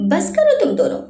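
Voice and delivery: high-pitched, exasperated outburst; a restrained cry of irritation; irritated scream. A narrator's reading. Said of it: "Parineeta चिढ़कर चीख पड़ी".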